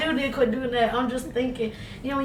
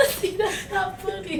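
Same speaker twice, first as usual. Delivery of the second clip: laughing; 7 semitones higher than usual. Real, not acted. Still laughing at her friend.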